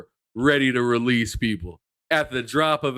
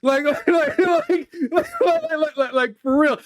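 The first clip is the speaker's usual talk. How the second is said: laughing; 3 dB louder than usual. Real, not acted. Laughing after impression.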